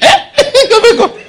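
high, loud squeal through laughter; laughing mock alarm. Preacher acting out the market errand, laughing mock alarm. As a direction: cry out high while cracking up.

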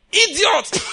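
high, loud yelled insult; insulting shout. Shown as a demonstration. Pastor demoing how people yell at a bad driver, shouted insult. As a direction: spit out the insult at a reckless driver. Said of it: "You know the way we say it?".

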